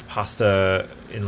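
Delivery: ordinary talk, nothing marked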